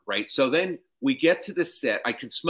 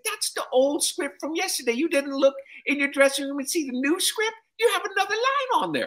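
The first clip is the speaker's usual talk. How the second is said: put-on high, scolding old man voice; lecturing Cosby impression. Comic as Cosby scolding him in front of cast, high lecture. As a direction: wag a finger in a squeaky lecture.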